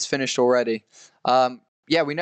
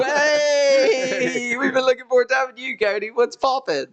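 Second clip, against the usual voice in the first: excited; 9 semitones higher than usual. Real, not acted. Hyped greeting to chat viewer.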